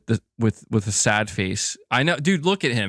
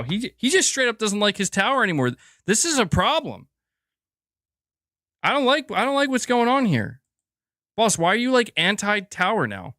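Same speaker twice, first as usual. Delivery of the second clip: much higher-pitched pet-owner complaint; mock dismay; 9 semitones higher than usual. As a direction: playfully fret at the cat, pitched way up. Mock dismay at cat rejecting tower.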